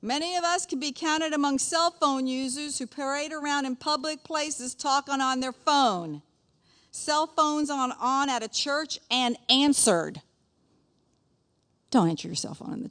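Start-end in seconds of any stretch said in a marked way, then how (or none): 0.0-10.2 high, slowing, measured reading voice; reading aloud. Acted. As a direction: read out the list of faults deliberately. Reads prepared lesson aloud.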